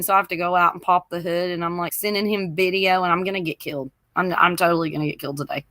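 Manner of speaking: ordinary talk, nothing marked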